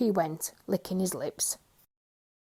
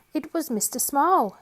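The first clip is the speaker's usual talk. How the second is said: ordinary delivery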